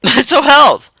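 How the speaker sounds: "Mental health" with a laugh, falling; laughing repeat. Real, not acted. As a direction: chuckle through "Mental health".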